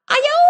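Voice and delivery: high, loud cartoon yelp; yelp of dismay. A narrator's reading. Skunk sprays the wolf, high loud yelp. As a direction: squeal at the stink.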